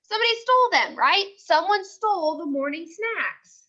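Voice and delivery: usual speaking voice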